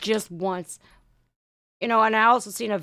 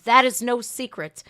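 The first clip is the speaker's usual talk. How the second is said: ordinary talk, nothing marked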